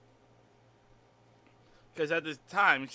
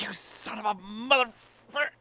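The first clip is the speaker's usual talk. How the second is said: toned-down mock insult; 5 semitones higher than usual. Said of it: "I can't be that".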